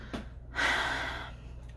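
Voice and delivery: long, unvoiced exhale; heavy sigh. Real, not acted. Vlogger letting go after getting worked up, long sigh. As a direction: let out a real, weary sigh. Sighs after venting her anger.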